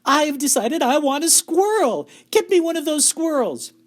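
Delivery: put-on high, loud bratty girl voice; spoilt demand. A narrator's reading.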